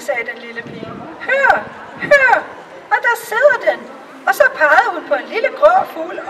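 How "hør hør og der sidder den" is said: much higher, eager hushed pointing; listen, there it is!; excited little girl. Acted. The kitchen girl shows the courtiers the bird, higher and excited.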